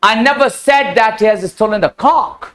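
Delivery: "cock" lifted and stretched at the end; stress on COCK. Shown as a demonstration. Teacher contrasting rooster with goat, stress on "cock". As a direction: save the weight for the final word "cock". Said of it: "मुर्गा तो ना".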